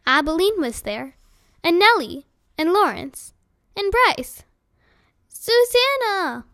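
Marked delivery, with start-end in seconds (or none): 5.3-6.5 calling out. A narrator's reading. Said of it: "called Nellie".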